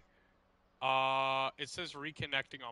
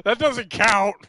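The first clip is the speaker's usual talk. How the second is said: high, loud laughing objection; loud protest; 8 semitones higher, 18 dB louder, slower than usual. Real, not acted. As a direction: shout it down, half laughing. Protesting the forfeited win.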